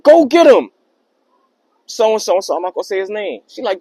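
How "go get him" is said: loud mom calling out; mother shouting. Retold or imitated. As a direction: shout it like a mom urging her son. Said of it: "I hear his mother say".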